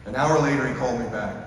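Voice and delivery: ordinary talk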